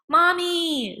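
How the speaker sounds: put-on high, loud child's cry for mommy; frightened girl crying out for her mother. A narrator's reading. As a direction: call for mother in panic. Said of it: "she cried".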